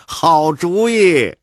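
amused. Acted. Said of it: "玉书也笑了".

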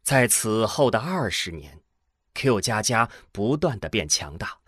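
ordinary delivery

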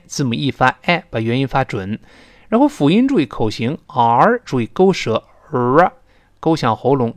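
usual speaking voice

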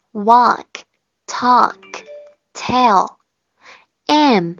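each letter and word said loud and alone with long gaps; reading the homework sounds for students to save; model reading. Shown as a demonstration. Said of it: "我先帮大家把这个所有的音".

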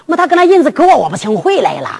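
normal talking voice

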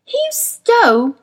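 put-on a girl snapping back, pitched high; indignant girl flaring up; hot retort. A narrator's reading. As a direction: cut in hotly like a furious child. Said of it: "began Lyra hotly".

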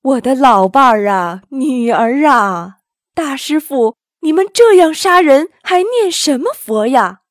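put-on storybook old man wailing and accusing; loud acted wailing. A narrator's reading. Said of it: "拍起胸脯大哭". (夸张地哭喊控诉)

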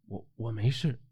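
very soft, faltering reply; weak stammer. A narrator's reading. Wenfeng answering helpers after collapsing, weak stammer. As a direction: barely voice it, tripping on the first word. Dazed after the possession ends.

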